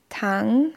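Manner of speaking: Tang rising from the middle; second tone. Shown as a demonstration. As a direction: let Tang rise like a question. (Tang im zweiten Ton, steigend)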